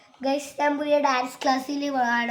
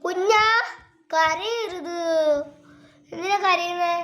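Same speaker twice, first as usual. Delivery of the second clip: higher, louder comforting call; 6 semitones higher, 7 dB louder than usual. Real, not acted. Child calling out to comfort crying Nandu. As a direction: coax the crying little one. Soothing crying little brother.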